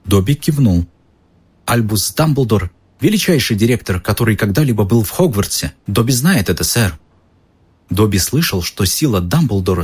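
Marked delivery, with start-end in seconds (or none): 1.6-7.0 an eager, awed house-elf. Acted. Dobby speaks with reverence.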